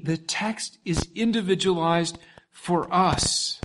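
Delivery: ordinary talk, nothing marked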